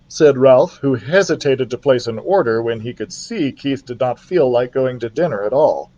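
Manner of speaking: plain reading voice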